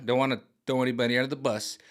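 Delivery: ordinary talk, nothing marked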